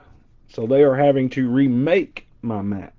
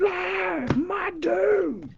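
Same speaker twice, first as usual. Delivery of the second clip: mock breathless high squeal, much higher; hyped yell; 9 dB quieter, much slower than usual. Breaker imitating himself hyped on Whatnot, squealing high.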